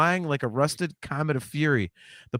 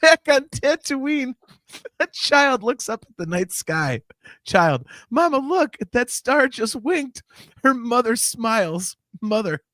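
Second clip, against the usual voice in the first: laughing while reading; 8 semitones higher, 4 dB louder, slower than usual. Acted. Giggly reading of coda.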